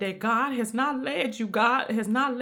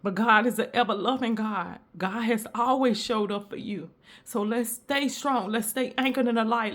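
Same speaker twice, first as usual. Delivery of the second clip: normal talking voice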